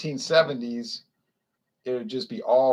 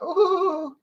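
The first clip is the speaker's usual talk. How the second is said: mock ooh. Women react to toast.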